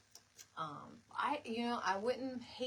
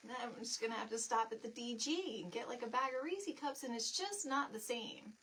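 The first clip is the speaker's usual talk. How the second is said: ordinary delivery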